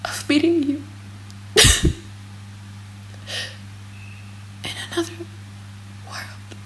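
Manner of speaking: whispered, dying away to nothing; last breath of a dying woman, whispered; fading whisper. A narrator's reading. Mother whispering her final words to her children, fading.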